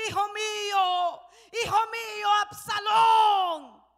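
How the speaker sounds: loud, slow, drawn-out cry of grief; wailing shout. Retold or imitated. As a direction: howl the son's name in anguish. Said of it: "empieza a gritar". (grito de dolor, lento y prolongado)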